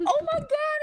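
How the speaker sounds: very high, breaking gasp of horror; imitating the clerk's mortified shock; shocked dismay. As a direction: squeal it as she realizes he's deaf. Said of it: "she was like ... started crying".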